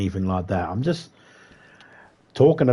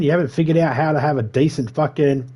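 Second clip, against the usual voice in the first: usual speaking voice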